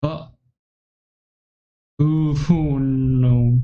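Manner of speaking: fading moan. Acted. Yuri losing consciousness, bleeding.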